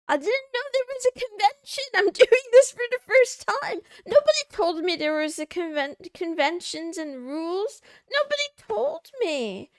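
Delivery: high, loud, panicky protesting; real flustered squeal, half laughing. Real, not acted. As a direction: plead your innocence in a rising panic. Panicked self-defence, high and loud.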